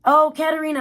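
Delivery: excited greeting. Real, not acted. Greeting arriving chat member.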